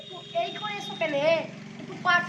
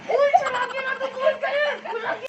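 higher, louder furious yelling; angry shout; 6 semitones higher, 5 dB louder than usual. Acted. Provoked friend yells in fury at the prankster. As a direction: finally explode at the teasing. Victim finally losing his temper.

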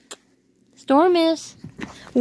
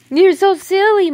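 excited; 9 semitones higher, 7 dB louder than usual. Real, not acted. Coos at silly puppy.

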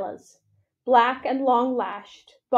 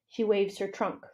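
usual speaking voice

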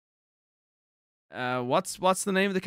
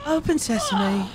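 slow, higher echo of a game character; mimicked game line; 4 semitones higher, slower than usual. Streamer copying the game character, slow mimicry. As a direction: parrot the character, drawn out.

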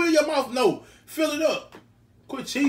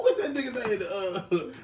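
ordinary talk

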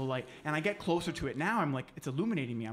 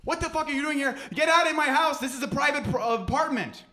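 high, loud outraged homeowner; angry yelling; 10 semitones higher, 8 dB louder than usual. Acted. Upset resident yells at a trespasser, furious. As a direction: order the intruder out at a shout. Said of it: "He was so angry".

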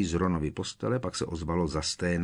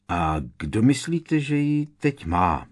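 louder question, slow, rising high at the end; drawn-out, emphatic asking; fired-off question; 4 semitones higher, 5 dB louder, slower than usual. A narrator's reading. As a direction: put the question pointedly, lifting the end. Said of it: "vypálil George".

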